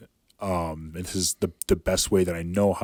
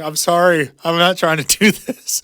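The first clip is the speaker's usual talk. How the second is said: higher and louder, a hammed-up sorry; mock frantic apology; 10 semitones higher, 8 dB louder than usual. Fighter apologizing to the crowd, mock high plea. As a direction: apologize to everyone in a panicked pitch.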